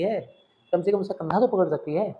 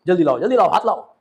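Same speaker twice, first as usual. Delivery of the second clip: much louder rushed command; hasty loud urging; 3 semitones higher, 9 dB louder than usual. Acted. Father loudly hurries Lomov to take his daughter's hand. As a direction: hurry them, nearly shouting. Father joins hands hastily.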